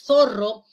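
zorro with a clear lisped θ, tongue between teeth; interdental z. Shown as a demonstration. Teacher modelling zorro with the th sound. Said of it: "La D debilitada es fricativa interdental".

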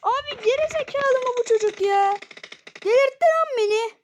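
screaming at a teammate in rage; high, loud raging yell; furious shout. Real, not acted. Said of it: "çok bağırdım ya".

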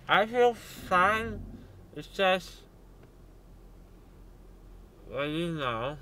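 talking with numb mouth; words slurred by numbness. Real, not acted. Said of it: "I can't feel my chin".

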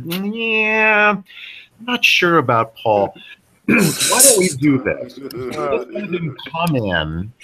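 slow, hesitant client mulling it over; doubtful client. Retold or imitated. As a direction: drag it out like a skeptical executive. Voicing a doubtful committee member.

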